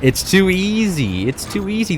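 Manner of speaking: high, drawn-out gloat. Real, not acted. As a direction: stretch it out, pleased with the easy win. Gloats over an easy win.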